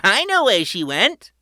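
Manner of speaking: put-on high, loud tour-guide kid; proud boy host. A narrator's reading. Patrick welcoming his family underground, high and proud. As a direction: announce it like a grand showman. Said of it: "Patrick said".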